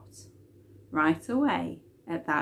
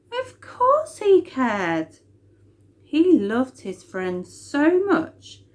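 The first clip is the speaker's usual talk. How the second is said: emphatic assurance; 5 semitones higher, 5 dB louder, slower than usual. A narrator's reading. Narrator emphasising love.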